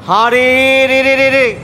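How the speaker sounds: loud, high, long rolling battle yell; ringing attack call; bandits' war cry. A narrator's reading. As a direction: holler it like charging bandits, held on a high note. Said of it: "ডাক ছেড়ে".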